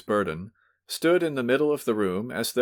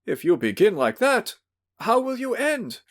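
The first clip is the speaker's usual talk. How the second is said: cowed protest; 14 semitones higher, 3 dB louder than usual. Acted. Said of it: "Pavel Pavlovich protested".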